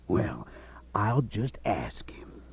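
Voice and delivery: hushed unvoiced whisper, slowed down; sly aside. King slyly whispers before signing to the duke, hushed. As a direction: whisper it as a private aside to the crowd. King feigns asking deaf brother.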